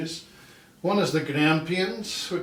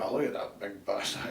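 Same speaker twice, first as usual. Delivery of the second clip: Scottish compliment; 6 semitones lower, 7 dB quieter, faster than usual. Retold or imitated. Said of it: "it can be a compliment".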